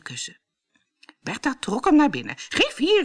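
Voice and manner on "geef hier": high-pitched demand; calling out. A narrator's reading. Bertha grabbing at the prince's slipper, calling out. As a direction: cry out to grab it first. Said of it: "riep ze".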